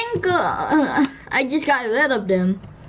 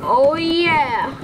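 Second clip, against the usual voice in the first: usual speaking voice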